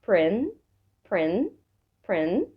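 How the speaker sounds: "prin" repeated alone with pauses; isolated first syllable. Shown as a demonstration. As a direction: sound out "prin" as one separate syllable. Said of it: "تقطعها لكام مقطع". (نطق المقطع "prin" منفصلًا ومكررًا)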